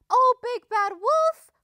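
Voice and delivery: very high, loud duck call; cried out. A narrator's reading. Missus Duck calling from the door to the departing wolf, crying out. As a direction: call after him in a high voice. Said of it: "cried Missus Duck".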